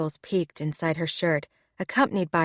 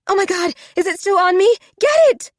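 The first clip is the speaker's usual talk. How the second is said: much higher and louder, frantic and fast; panicked shriek; 12 semitones higher, 8 dB louder, faster than usual. Acted. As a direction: squeal in panic as a spider crawls on you. Rosie panics over a spider.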